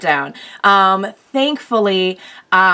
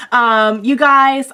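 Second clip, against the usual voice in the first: ordinary talk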